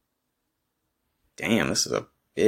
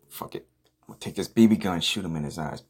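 ordinary talk

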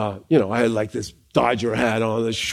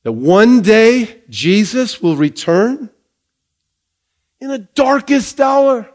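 rising, emphatic sermon delivery; full-force pulpit preaching; 3 semitones higher, slower than usual. Acted. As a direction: preach it out to the church with conviction. Preaching to the congregation.

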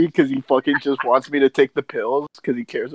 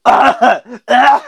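loud, high retching "ah"; mock spitting-up noises; imitated gagging; 9 semitones higher, 6 dB louder than usual. Kid spitting out the pills, imitated gag. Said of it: "I spit out the fucking pills".

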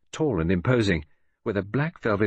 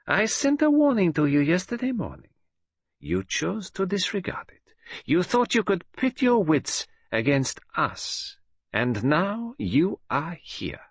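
much higher, slow, whispered menace at the end; menacing villainess; 10 semitones higher than usual. A narrator's reading. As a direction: gloat over a trapped enemy. Villainess threatens captives.

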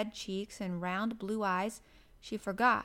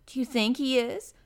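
louder, eager rising question; eager cry; 3 semitones higher, 7 dB louder than usual. A narrator's reading. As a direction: ask it eagerly, badly wanting to know. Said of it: "cried Mary eagerly".

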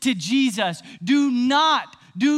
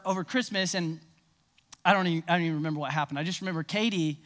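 normal talking voice

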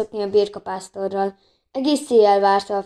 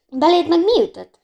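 surprised; 8 semitones higher, 6 dB louder than usual. A narrator's reading. Anna startled by Bori's grin.

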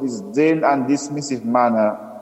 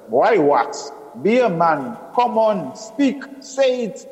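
impatient father, climbing steadily higher; growing voice; 7 semitones higher than usual. A narrator's reading. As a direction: build from demand to high-pitched urging. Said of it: "His voice grew".

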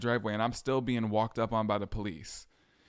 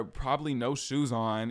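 ordinary talk, nothing marked

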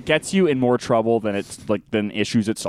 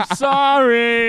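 high, slow mock wail; hammed-up insincere sorry; sarcastic apology; 11 semitones higher, 4 dB louder, much slower than usual. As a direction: drag out the fake apology up high. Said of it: "Than a sarcastic sorry".

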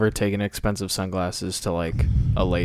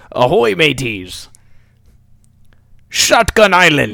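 loud, high pirate holler; pirate style; 6 semitones higher, 9 dB louder, slower than usual. Pretend. Joking about how they should have landed, pirate voice. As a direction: bellow it slowly like a mock pirate hailing the crew. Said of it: "done it fucking pirate style".

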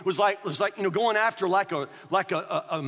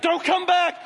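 much higher, louder mock yell; angry mother; 11 semitones higher, 7 dB louder than usual. Mother ordering the kids away, high loud yell. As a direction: bark it like a furious mom.

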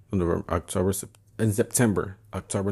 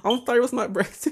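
mock apology; 4 dB louder than usual. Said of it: "I was like".

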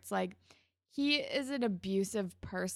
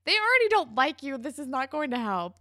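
exasperated; 5 semitones higher, 6 dB louder than usual. Retold or imitated. Viewer warning Emma in mock exasperation.

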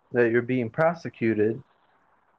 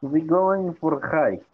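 put-on German accent; 4 semitones higher, slower than usual. Echoing the accent.

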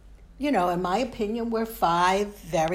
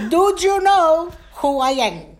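much higher, louder challenge from dad; outraged father; 10 semitones higher, 8 dB louder than usual. Acted. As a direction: hammer it out as an indignant father, falling at the end. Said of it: "he would look at me and say".

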